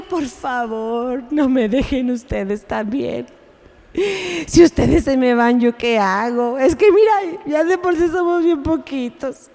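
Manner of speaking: a hammed-up, begging Jesus pleading with his disciples; mock whine, please don't leave; mock whining plea. Preacher mocking a whining Jesus, begging disciples not to leave. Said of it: "Jesús no se puso a chillar".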